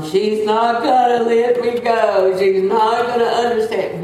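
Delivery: mimicking a man hollering in distress; imitated hollering. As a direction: holler it out like the patient next door. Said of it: "He was hollering".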